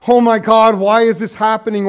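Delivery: loud anguished cry to God; retold cry. Pastor voicing Irma's cry, loud anguish. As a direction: cry it out as a grieving widow. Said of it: "she'd cry out".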